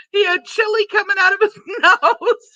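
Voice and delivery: high-pitched, words squeezed out through laughter; giggling through the punchline; laughing. Real, not acted. Reading a viewer's joke aloud to chat, laughing through it.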